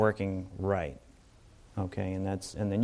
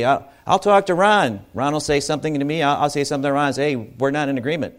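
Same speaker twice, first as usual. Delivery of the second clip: retold quick exchange; 4 semitones higher, 4 dB louder, faster than usual.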